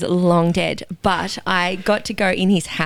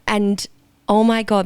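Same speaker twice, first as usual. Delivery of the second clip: normal talking voice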